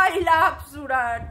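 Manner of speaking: "I love সুরাট" slurred and drawn out; slow singsong mock of "I love সুরাট"; mocked evasive pronunciation. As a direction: stretch "I love সুরাট" so the words blur. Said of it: "উচ্চারণ যাতে করে আবার কেউ না ধরতে পারে".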